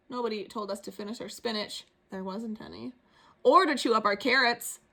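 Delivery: ordinary delivery, nothing marked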